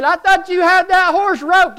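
high, loud indignant complaint; accusing. Retold or imitated. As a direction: whine it at him accusingly. Complaining to Ralph.

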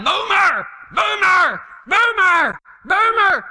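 high yell for the dog, over and over; steady yelling. Retold or imitated. Owner hollers at dog that ignores the shock collar, steady high yelling. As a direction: keep hollering the dog's name. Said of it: "he's steadily yelling".

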